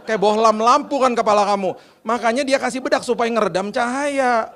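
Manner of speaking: high, loud, exasperated wife; teasing high-pitched impression of his wife; wife explaining. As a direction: imitate a wife spelling out the obvious. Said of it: "istri saya bilang gini".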